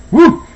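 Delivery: high loud whoop from heat; loud woo. Real, not acted. Hot spicy soup reaction.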